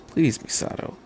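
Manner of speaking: soft whispered plea; inner thought. A narrator's reading. Shinji silently begging Misato, whispered. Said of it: "he thought".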